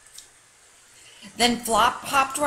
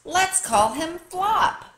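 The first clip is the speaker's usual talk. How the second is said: happy suggestion; 10 semitones higher, slower than usual. A narrator's reading. Child naming her new bunny.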